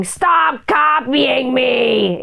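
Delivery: slow, drawn-out adult whine; adult complaining like a child; mock grown-up whine. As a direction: stretch it out like a petty adult. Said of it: "grown-ups right now screaming".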